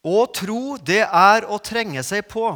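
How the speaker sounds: loud, slow proclamation; preacher declaring the sermon's motto; proclaiming. Acted. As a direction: hammer the key sentence home. Key sentence proclaimed.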